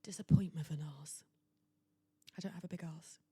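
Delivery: hushed whisper. Real, not acted. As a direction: whisper it like a secret confession. Whispered aside about her body.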